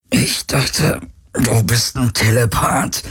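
effortful, forced-out words; strained retort. A narrator's reading. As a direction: push the retort out under strain. Weakened hero taunts the phantom. (angestrengt gepresste Erwiderung)

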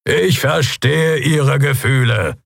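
high, slow official's call; unshakeable captain. Acted. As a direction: address the crowd firmly, drawing it out. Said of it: "blieb unerschütterlich wie ein Fels".

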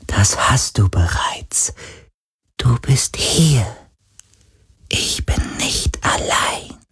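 langsam, zärtlich geflüstert; zartes Flüstern. A narrator's reading. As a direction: haucht es dankbar. Geist flüstert dankbar. (sanftes Geisterflüstern)

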